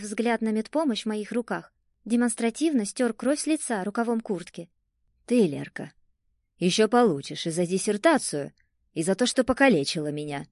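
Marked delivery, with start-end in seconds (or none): 5.3-10.5 a low, angry threat pushed out through clenched teeth; angry, through gritted teeth. Acted. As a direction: let the grudge seep out through gritted teeth. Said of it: "Процедил он сквозь зубы со злостью".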